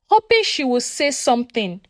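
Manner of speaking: plain reading voice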